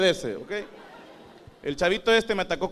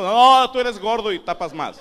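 put-on kids' dismissive reply; 4 semitones higher, 8 dB louder, slower than usual. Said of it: "Me tiran abajo".

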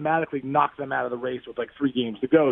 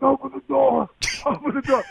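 urgent yell; 10 semitones higher than usual. Retold or imitated. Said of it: "I'm like".

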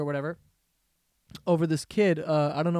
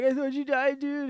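mock relieved; 9 semitones higher than usual. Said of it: "the kid's like".

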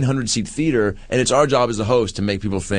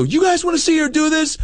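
rile up the audience; hyping the crowd. Retold or imitated. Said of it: "I turn around and go".

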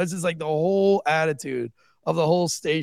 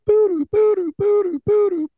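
much higher, louder sung two-note alert; imitated alarm tone; 7 dB louder than usual. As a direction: mimic the dispatch alarm beep. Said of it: "a tone that sounds like this".

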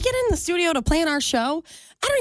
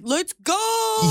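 mocking hype; 7 semitones higher than usual. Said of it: "You're like".

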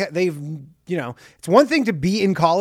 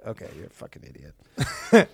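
a low, dry dismissal of an idiot; deadpan insult; 12 semitones lower, 5 dB quieter than usual. Retold or imitated. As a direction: drop the voice much lower, flat put-down. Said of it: "And you go".

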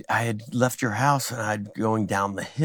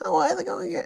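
higher, lightly mocking Jay voice; imitating Jay; 4 dB quieter than usual. Jay greets the comic in a higher mock voice. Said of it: "First thing he says".

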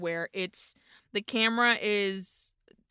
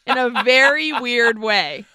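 louder, teasing sympathy; sympathetic sass; 3 semitones higher, 7 dB louder than usual. Retold or imitated. Host addressing the crying boy with loud teasing sympathy. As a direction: coo it to the poor boy. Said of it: "I was like".

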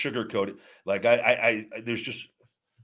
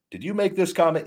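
lawyer questioning; 10 semitones higher, 4 dB louder than usual. Retold or imitated. Mock deposition question from attorney.

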